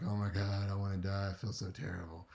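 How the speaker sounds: low, fading complaint to a friend; hungover groaning. Acted. Hungover friend on a Sunday morning call, low groaning. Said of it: "wake up with a terrible hangover headache".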